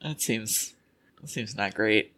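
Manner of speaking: quiet and dry; deadpan murmur. Real, not acted. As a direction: mutter it flatly under your breath. Dry understated reaction.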